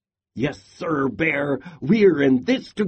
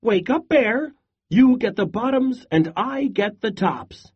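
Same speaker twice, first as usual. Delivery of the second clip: calling out; 7 semitones higher than usual. A narrator's reading. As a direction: yell it over to the sleeping bear. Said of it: "Hare called out".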